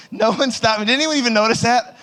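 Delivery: high comic indignation; incredulous. Real, not acted. Comedian to audience who let it slide, incredulous. Berates audience for not telling him.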